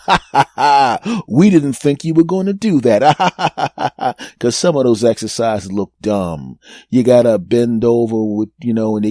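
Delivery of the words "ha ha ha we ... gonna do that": hammed-up cackling as pranksters; mock laughter. Friends burst in laughing at their prank victim, mock laughter. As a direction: laugh through the line, mockingly. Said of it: "bust into the room laughing and going".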